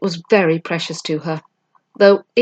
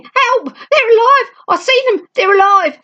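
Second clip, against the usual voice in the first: very high, loud panicked screams; a thug fleeing in sheer panic; shrieking hysterical terror; terrified yelling; 5 dB louder than usual. A narrator's reading.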